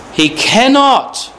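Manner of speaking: cannot stretched high and slow; stress on cannot. Shown as a demonstration. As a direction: pull out the word cannot. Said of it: "there's that word cannot there".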